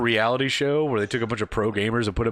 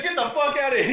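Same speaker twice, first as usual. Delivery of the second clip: mock angry yell; 13 semitones higher than usual. Gamer telling his rival to silence his girlfriend, angry mock yell.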